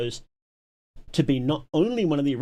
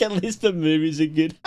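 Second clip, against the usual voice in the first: louder, laughing self-parody; mock quote of herself; 3 semitones higher, 4 dB louder than usual. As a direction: replay the line as a catchphrase. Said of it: "has been like".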